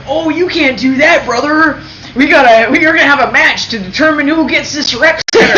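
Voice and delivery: high, loud pro wrestler bellow; wrestler promo shout. Retold or imitated. Imitating Hulk Hogan in wrestling promo.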